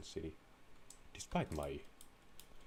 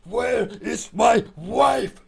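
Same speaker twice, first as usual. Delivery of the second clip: shrill high mock shriek at Ben, much louder; hammed-up screaming panic; 14 dB louder than usual. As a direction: scream it all out in fake terror.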